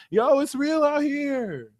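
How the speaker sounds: ordinary talk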